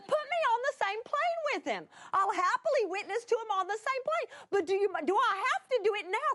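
high-pitched, insistent begging; acted wheedling protest; pleading bargain. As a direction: haggle desperately with God. Bargaining with God.

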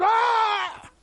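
loud high anguished cry; shouted wail of grief; acted wail. Preacher acting Peter's wail.

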